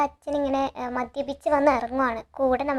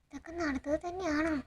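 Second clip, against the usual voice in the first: much softer, hushed thinking aloud; inner thought; 13 dB quieter than usual. Retold or imitated. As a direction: murmur it to yourself in disbelief. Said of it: "Gautam ഇങ്ങനെ ആലോചിക്കുകയാണ്". (സ്വയം ആലോചിക്കുന്ന പതിഞ്ഞ ശബ്ദം)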